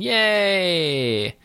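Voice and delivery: high, drawn-out playful yay; a light pretend hurrah; mock victory cheer. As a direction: cheer the win in play. Cheering the win while retelling.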